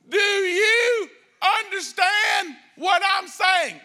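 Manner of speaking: hammed-up shout to an Uber driver with a laughing edge; loud slow shout at a foreigner. Shown as a demonstration. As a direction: yell each word slowly to someone who can't understand. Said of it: "start talking louder".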